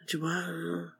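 very soft, barely-awake mock reply; groggy mumble imitation. Imitating Nikki half-hearing the news, groggy mumble. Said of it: "you would be like".

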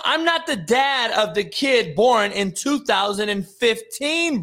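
usual speaking voice

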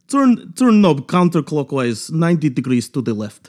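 put-on Russian accent, starting loud and high. Shown as a demonstration. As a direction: give instructions in a measured Russian accent. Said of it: "maybe Russian".

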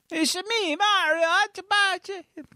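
put-on high, hammy Italian Mario accent; bad Italian accent. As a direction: overdo a cartoon Italian accent. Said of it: "a really bad Italian accent".

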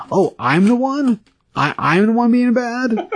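high, slow, offended squeak; indignant cat. Retold or imitated. Cat answering mom's scolding, high slow indignant squeak. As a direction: play the wronged little cat, outraged. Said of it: "Cinnamon's response to that is".

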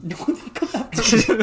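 laughing, high and loud. Real, not acted. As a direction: protest through laughter. Laughing protest.

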